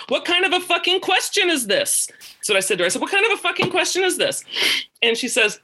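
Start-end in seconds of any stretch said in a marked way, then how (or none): 0.1-2.1 much higher-pitched, exasperated retelling; indignant retort. Retold or imitated. Indignant retort retold from the phone call. 3.0-4.4 exasperated re-enactment, pitched way up; indignant retort. Retold or imitated. Repeats indignant retort from phone call.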